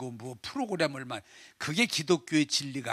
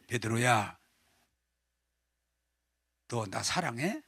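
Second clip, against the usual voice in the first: tender question; 5 semitones lower than usual. Acted. Jesus asks Peter first time.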